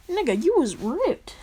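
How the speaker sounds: high, swooping, astonished exclamation; exaggerated impressed outburst, pitch leaping up; mock amazement. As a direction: imitate a friend gawking in disbelief. Acted friend amazed at physique.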